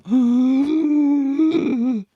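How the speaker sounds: loud, high noisy inhale; asthmatic gasp. Shown as a demonstration. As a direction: wheeze the air in hard. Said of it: "he's got asthmatic".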